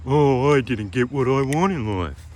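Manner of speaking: whiny imitation of someone feeling sorry for themselves; mock self-pity. Mocking a depressed man who blames life, whiny. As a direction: put on a mopey, sulky voice.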